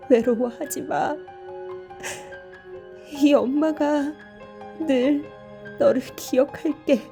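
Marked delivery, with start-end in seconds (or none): none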